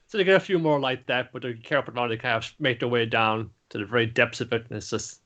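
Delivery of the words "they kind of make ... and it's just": ordinary talk, nothing marked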